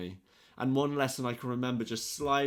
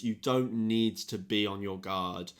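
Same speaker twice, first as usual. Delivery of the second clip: normal talking voice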